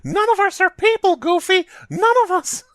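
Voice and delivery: squeaky, very high cartoon falsetto; Mickey Mouse impression. Mouse protesting to Goofy, squeaky high falsetto. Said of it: "I got you to say it, Mick".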